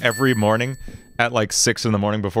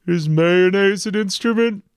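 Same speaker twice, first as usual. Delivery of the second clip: much higher, louder, dopey starfish voice; Patrick; 9 semitones higher, 3 dB louder than usual. Pretend. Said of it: "I can do Patrick".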